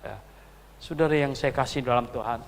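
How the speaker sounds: usual speaking voice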